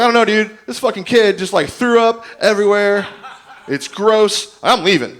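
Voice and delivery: loud, high-pitched feigned disgust; indignant denial. Retold or imitated. Rider blames the sick mess on the kid, indignant. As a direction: act innocent and grossed out, loudly. Acted excuse blaming the kid.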